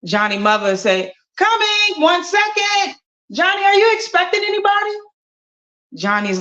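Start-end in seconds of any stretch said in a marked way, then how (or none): 1.4-5.0 high sing-song call toward the door; calling mom. Acted. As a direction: call out brightly.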